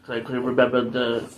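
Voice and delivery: normal talking voice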